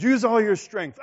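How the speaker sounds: loud, high command; father calling out to use all his strength; urging father. Retold or imitated. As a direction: push him to strain harder.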